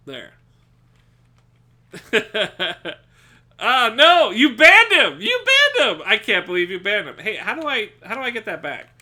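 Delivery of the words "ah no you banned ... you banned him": loud, very high shocked cry; shocked shout when a friend gets banned; real shocked yell. Real, not acted. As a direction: cry out in disbelief. Mod bans his friend.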